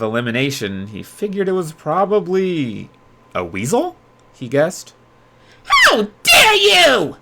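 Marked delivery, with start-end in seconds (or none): 5.6-7.2 high, loud, slow outraged creature cry; Grim yelling. A narrator's reading. Grim yells at Yuya for calling him a weasel, high and loud. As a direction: wail it out, deeply insulted. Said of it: "the stuffed animal yelled".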